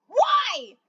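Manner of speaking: high, shocked question; gasping why. A narrator's reading. Cat gasps at the death threat, shocked. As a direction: gasp it out in disbelief. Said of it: "Acorn Tail gasped".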